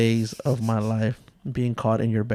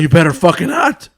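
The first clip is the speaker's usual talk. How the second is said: loud, raised-pitch threat; angry dad; 6 semitones higher, 8 dB louder than usual. Retold or imitated. Dad warns son off drinking on the pod, angry and loud. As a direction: imitate a dad laying down the law.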